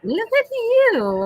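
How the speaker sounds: delighted high-pitched cooing cheer; gushing excitement. Real, not acted. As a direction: gush it, stretched way up high. Gushing over the streamer.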